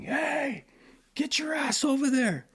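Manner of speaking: high-pitched imitation of a bossy veteran barking orders; mock yell as Hovland. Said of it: "I just hear him yelling".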